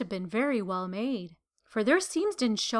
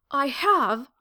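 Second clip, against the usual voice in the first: louder, protesting cry; 3 semitones higher, 5 dB louder than usual. A narrator's reading. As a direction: insist hard, louder, hurt. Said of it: "cried the little rabbit".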